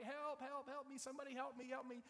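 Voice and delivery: high-pitched, hurried cries; mock screaming for help. Man hanging off a cliff, mock screaming for help. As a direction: call out in pretend panic. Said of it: "he starts screaming for help".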